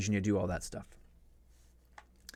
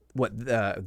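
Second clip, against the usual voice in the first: ordinary talk, nothing marked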